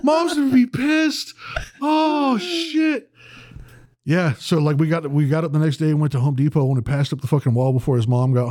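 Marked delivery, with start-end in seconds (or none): 0.0-3.1 very high, laughing squeaky voice; hammed-up giddy friend; giddy Ian. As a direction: gush like a delighted drunk friend. Ian's giddy reaction continues.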